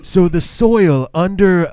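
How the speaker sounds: put-on slow, halting old-president ramble; lightly voiced Reagan losing his thread, slow; rambling Reagan impression. Mocking an elderly Reagan rambling about cars and planes, slow. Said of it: "that was Reagan towards the end".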